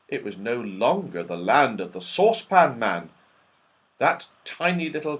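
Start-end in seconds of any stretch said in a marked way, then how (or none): none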